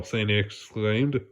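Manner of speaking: usual speaking voice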